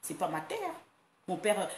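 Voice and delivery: normal talking voice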